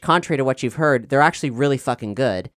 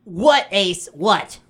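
put-on bratty kid brother; 7 semitones higher, much slower than usual. Younger brother answers in scene.

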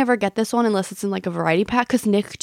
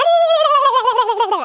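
loud high gobbling call; turkey call; 4 dB louder than usual. Retold or imitated. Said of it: "we would just yell at each other like that".

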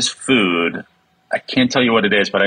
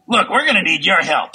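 higher lightly voiced raccoon; mock Rocket voice; 7 semitones higher than usual. Joining the joke as Rocket, lightly higher. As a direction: toss off a hint of Rocket.

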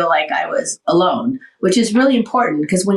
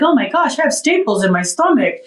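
higher-pitched startled recollection; retold shock; 4 semitones higher than usual. Patient retelling her discovery at her incisions, mildly shocked. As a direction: replay the sudden alarmed discovery.

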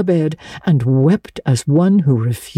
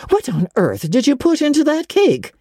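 alarmed Marilla; 12 semitones higher than usual. A narrator's reading.

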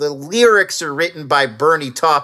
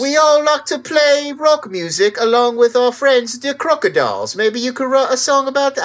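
put-on Cockney accent; 7 semitones higher than usual. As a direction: drag it out in a London Cockney voice. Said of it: "I don't know why he's Cockney now".

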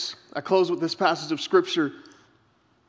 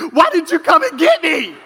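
high, loud indignant shout; mock outraged wife; 9 semitones higher, 6 dB louder than usual. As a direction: imitate a wife yelling her grievance. Wife returns, pulls covers off.

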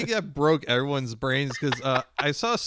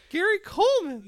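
ordinary talk, nothing marked

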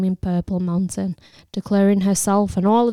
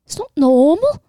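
high, rising anxious protest, louder on normal; frightened protest; 8 semitones higher, 6 dB louder than usual. A narrator's reading. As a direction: plead in alarm. Lily protests in fear.

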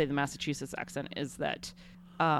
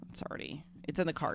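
an unvoiced, much softer hush; whispered aside; 10 dB quieter, faster than usual. Real, not acted. Parent whispering that the son's Burning Man fate is sealed. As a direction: whisper it like a secret.

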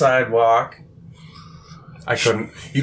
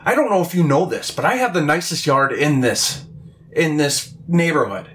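much higher, slow, outraged homeowner; imitating the angry homeowner protecting her lawn; indignant lady; 7 semitones higher, slower than usual. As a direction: play the snooty lady scolding him off her lawn. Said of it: "she comes out yelling at me".

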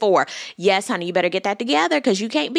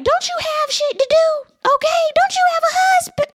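much higher, squeaky mock voice at Candace; mocking squeal. Host mocking Candace for picking fights, squeaky high mock voice. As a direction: squeal it like a scandalised girlfriend.

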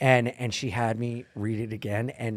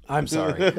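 normal talking voice

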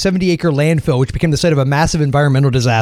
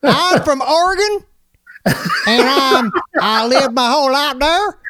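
faux Southern accent; 8 semitones higher than usual. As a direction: tell a story as a cartoon Southern bumpkin. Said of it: "put on this kind of faux Southern accent".

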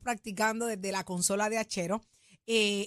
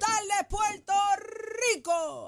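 high, bright radio greeting call; announcer shout; 8 semitones higher, slower than usual. Shown as a demonstration. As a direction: belt the greeting like a host. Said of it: "Así".

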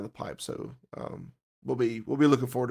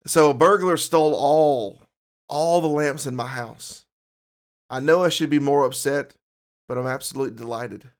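dad joke delivery; 2 semitones higher, 7 dB louder than usual. Shown as a demonstration.

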